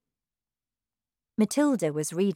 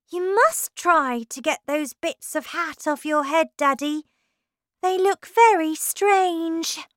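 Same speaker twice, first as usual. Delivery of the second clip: put-on lightly higher little-girl voice; a child's earnest voice, pitched up; child Matilda; 10 semitones higher, 4 dB louder than usual. A narrator's reading. Matilda telling her father about his hat, light girl voice.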